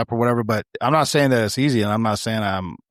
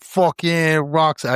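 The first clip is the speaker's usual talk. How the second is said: drawn-out, higher attempt at his accent; attempted accent of Stoll; 5 semitones higher, much slower than usual. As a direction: have a go at his accent, stretching the words. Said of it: "I can't do his fucking accent".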